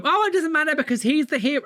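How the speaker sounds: high, quick mock-fan voice; high-pitched mock adoration; gushing devotee. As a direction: make excuses like a besotted follower. Voicing a blindly devoted supporter.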